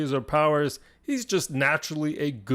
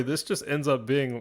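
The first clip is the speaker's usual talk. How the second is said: ordinary talk, nothing marked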